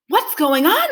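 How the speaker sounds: high, loud alarmed cry; cried out. A narrator's reading. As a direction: call out in surprise. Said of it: "they cried".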